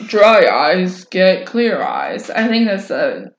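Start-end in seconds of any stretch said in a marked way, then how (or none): 0.1-2.3 very slow, monotone teacher; monotone Dry Eyes voice. Acted. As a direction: drone it out like a bored-sounding lecturer. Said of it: "very monotone teacher. Sounds like the Dry Eyes voice".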